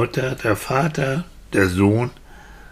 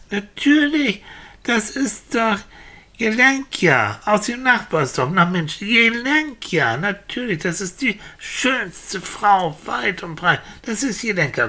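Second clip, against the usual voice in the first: higher, emphatic cry of recognition; delighted villager with the answer; 7 semitones higher than usual. Acted. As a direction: announce it like a sudden certainty. (begeisterter Dorfbewohner, dem es einfällt)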